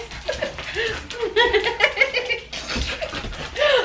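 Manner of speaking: high squealing laugh; delighted laughter. Real, not acted. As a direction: laugh with joy. Reunion with her dog.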